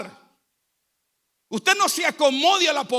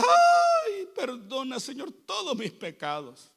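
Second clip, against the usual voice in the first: mock tearful pleading; slower than usual. Preacher imitating a weeping penitent before God, mock crying. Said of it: "llorar con lágrimas insostenibles".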